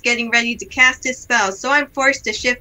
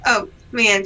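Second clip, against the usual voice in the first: ordinary talk, nothing marked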